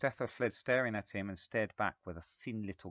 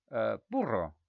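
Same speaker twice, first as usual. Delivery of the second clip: put-on Italian accent; 7 dB louder than usual. A narrator's reading. Italian guest repeats.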